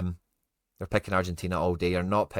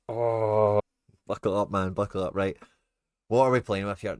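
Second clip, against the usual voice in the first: ordinary talk, nothing marked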